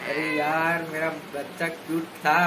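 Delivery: normal talking voice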